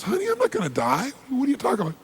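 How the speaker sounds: high-pitched, incredulous protest, acted; bewildered father. Father answers his crying daughter, acted bewilderment. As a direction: brush it off in baffled disbelief.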